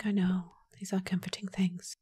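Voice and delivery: soft unvoiced whisper. Real, not acted. Streamer whispering to herself about creepy toys. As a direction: whisper it like a secret.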